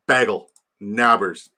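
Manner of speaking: "Bagel" as "baggle", "Nabbers" as in "nab"; short a of "bag" in "Bagel" and "Nabbers"; mocking mispronunciation. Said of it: "the vowel A".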